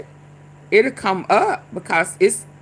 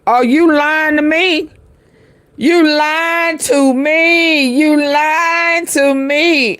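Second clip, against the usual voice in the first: higher, louder, drawn-out "you lying to me"; incredulous raised call-out; loud accusation; 5 semitones higher, 5 dB louder, slower than usual. Real, not acted. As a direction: call out the liar, stretching each word slowly. Calling out a liar on video.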